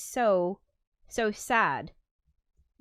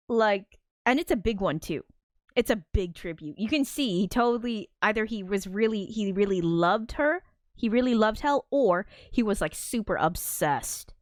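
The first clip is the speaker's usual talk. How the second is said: usual speaking voice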